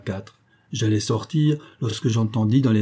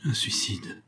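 lifeless, hushed murmur; barely voiced shock; faint murmur; 8 dB quieter, slower than usual. A narrator's reading. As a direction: murmur it much softer, voice drained. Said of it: "murmura José Ferbal d'une voix éteinte".

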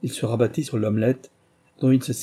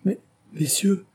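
high, slow, despairing plea; pleading, desperate outcry; desperate exclamation; 10 semitones higher than usual. A narrator's reading. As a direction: appeal to them in despair, pitch way up. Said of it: "s'exclama Bertie désespéré".

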